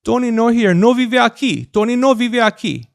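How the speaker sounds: put-on loud high Spanish-accented voice. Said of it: "I actually say my name like that".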